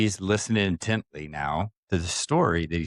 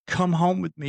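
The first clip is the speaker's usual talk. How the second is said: higher, imploring; pleading, pitched up high; retold insistent plea; 9 semitones higher than usual. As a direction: act out begging over and over. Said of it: "and over and over".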